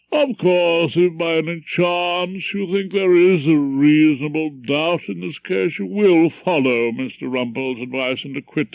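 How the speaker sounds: put-on pompous old judge addressing the jury; stern judge. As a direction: lecture the jury from the bench.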